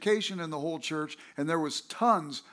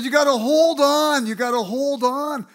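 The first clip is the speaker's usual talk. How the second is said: loud, high urgent shouting of an altar helper; loud and high, hammering the word hold; shouted exhortation; 6 semitones higher, 11 dB louder than usual. Retold or imitated. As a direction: bellow it like a revival exhorter pressing you.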